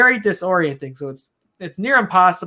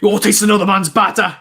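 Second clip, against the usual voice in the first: put-on louder, higher, faster try at his accent; Peter Quinn accent attempt; 5 semitones higher, 6 dB louder, faster than usual. Friend imitating jealous Peter Quinn, loud mock accent. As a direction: snap it out in a put-on British voice. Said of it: "That's not him either".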